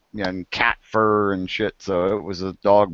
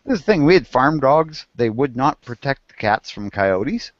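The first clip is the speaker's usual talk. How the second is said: ordinary delivery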